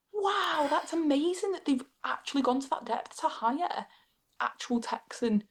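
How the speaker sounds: imitating a wowed guest, high-pitched; amazed guest. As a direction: gush with mock amazement. Retold guest amazed by the accent.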